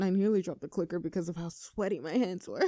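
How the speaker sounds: ordinary talk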